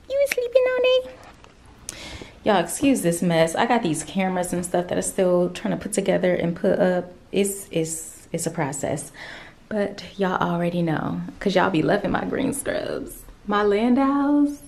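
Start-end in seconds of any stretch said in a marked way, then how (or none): 0.1-2.0 much higher, teasing pet voice. Real, not acted. 13.5-14.6 excited. Real, not acted. Gushing about scrubs.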